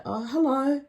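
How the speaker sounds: polite greeting. Retold or imitated. Dancer to a man she barely looked at, polite. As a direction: politely greet someone you barely want to see. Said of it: "but politely I say".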